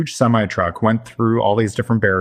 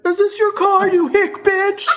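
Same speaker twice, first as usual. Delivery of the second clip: high-pitched taunting imitation; mock hostile; slower than usual. Vandal to the car's owner, mock hostile. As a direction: confront the owner, exaggerated for laughs.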